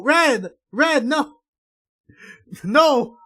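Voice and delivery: desperate high shouts of a name, panicking; frantic screaming. Acted. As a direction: scream at him to stop, terrified. Frantic plea as Red transforms.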